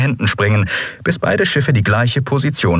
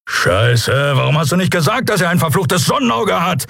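rising, higher accusing shout; furious at being kept in the dark; angry outcry; 10 semitones higher than usual. A narrator's reading. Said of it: "rief Keru".

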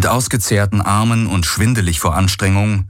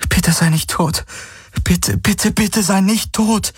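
put-on high, tearful girl's plea; Kirai pleading; 12 semitones higher than usual. A narrator's reading. Kirai begs the dying Hawks, high desperate plea. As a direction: beg him not to die, slowing at the end.

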